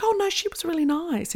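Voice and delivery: high-pitched, placating reenactment; mock-innocent high voice; retold protest. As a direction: pitch it way up, defending her. Retold protest in director's defence.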